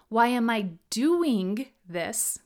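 slow, with DOING lifted and drawn out; stress on DOING. Shown as a demonstration. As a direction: hit the word "doing". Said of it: "each variation puts the emphasis on a different word".

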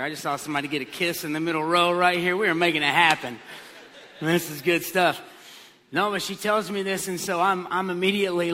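normal talking voice

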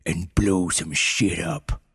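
gruff, commanding voice in her head; gruff commanding penguin. A narrator's reading. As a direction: bark the order like a tough penguin. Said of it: "the penguin's gruff and commanding voice".

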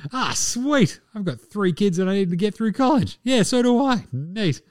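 high-pitched, put-on delighted voice; delighted stripper. Strippers chatting about kids' college money, mock delighted. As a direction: play a thrilled gossip in a high voice.

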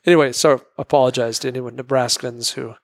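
normal talking voice

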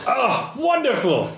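high gushing exclamation; hammed-up delight. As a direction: gush like a delighted aesthete. Contact delighted by fabric samples.

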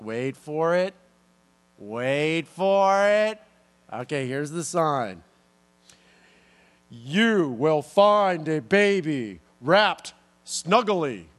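slow, drawn-out booming angel voice with big pauses; milk the suspense like a grand heavenly herald; dramatic angel. Pretend. Angel teasing the shepherds with the sign, slow and dramatic. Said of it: "I'd do a total dramatic pause right there".

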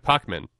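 "Pac-Man" said as "POCK-man"; mispronounced. Real, not acted. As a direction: slip on "Pac-Man", first vowel like "pock". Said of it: "I don't know if I'm getting worse with pronouncing things".

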